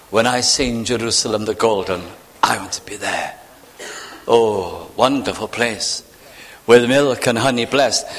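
ordinary delivery

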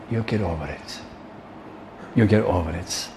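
soft, low, acted reassurance; understated, patronising murmur; dismissive brush-off. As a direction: quietly wave the worry away. Acts principal brushing off young preacher.

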